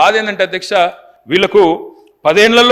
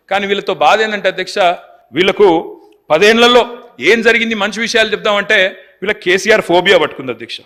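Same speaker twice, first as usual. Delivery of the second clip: ordinary delivery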